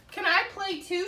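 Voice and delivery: small eager voice asking; little bird. A narrator's reading. The blue bird asking Duck and Goose to play, high and eager.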